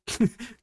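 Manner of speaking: brief high chuckle; short chuckle. Real, not acted. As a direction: chuckle briefly mid-story. Amused by own memory.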